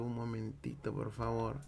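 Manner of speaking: usual speaking voice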